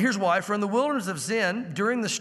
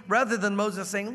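ordinary talk, nothing marked

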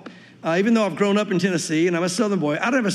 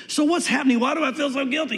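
higher, mildly fretful self-questioning; anxious questioning; 6 semitones higher than usual. Acted. Believer wonders about lingering guilt, anxious questioning. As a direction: voice a worried believer's doubts.

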